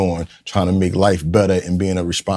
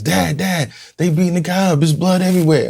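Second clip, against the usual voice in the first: put-on alarmed child; 9 semitones higher than usual. Son calling out about the beating.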